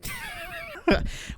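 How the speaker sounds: high-pitched giggle; high laugh. Real, not acted. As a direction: burst out laughing at the joke. Laughing at his own joke.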